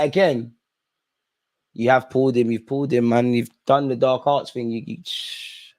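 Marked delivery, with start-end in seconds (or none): 4.7-5.7 slow breathy whisper "you, you, shh"; whispered shush. Real, not acted. Fan stops his own rant with a whispered shush. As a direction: hush yourself in a slow whisper.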